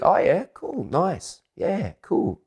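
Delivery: very high, parodied Australian agreement; mock Australian small talk. Australians flirting in short approvals, high mocking imitation. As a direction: trade little high-pitched Australian approvals. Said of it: "This is Australian".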